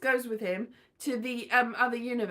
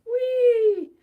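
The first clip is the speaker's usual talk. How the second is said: high, loud whee; gleeful high whee; playful whee; 9 semitones higher, 9 dB louder than usual. Pretend. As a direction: flap the cape and squeal whee. Showing off cape armholes.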